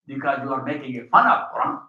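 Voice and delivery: normal talking voice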